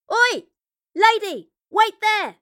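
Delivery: loud high shout from afar; calling out. Acted. Stranger calling Jane from the hillside, loud high shout. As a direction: yell across the hillside. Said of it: "a voice calling from the hillside".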